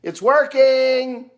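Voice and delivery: high, drawn-out call from behind the trailer; sister yelling. Retold or imitated. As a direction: shout it up to the truck, high and slow. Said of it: "she yells up at him".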